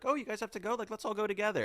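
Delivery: high-pitched imitation of an eager offer. Star invites group to the bathroom together, high imitation. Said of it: "And [name]'s like".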